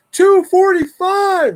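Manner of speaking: high, loud, slow answer; calling back. Retold or imitated. Neighbor calls the time back across the street, high loud call.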